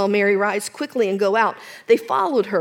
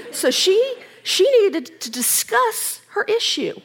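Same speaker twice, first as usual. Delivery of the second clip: eager; 10 semitones higher than usual. Acted. Preacher voicing eager Martha.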